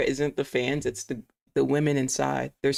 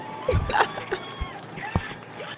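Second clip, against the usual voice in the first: real incredulous laughter; high cackling laugh of disbelief; 4 dB quieter than usual. Real, not acted. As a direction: burst out laughing, high-pitched. Laughs at commentator's phrase.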